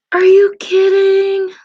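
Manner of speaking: high, loud, drawn-out astonishment; real shock, voice shooting up; astonished disbelief. Real, not acted. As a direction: cry out slowly in amazed disbelief. Astonished by news from chat.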